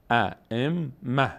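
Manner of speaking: ائمه very slow, syllable by syllable, ending -mah; pausal h reading. Shown as a demonstration. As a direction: hold the doubled m in ائمه, close on h.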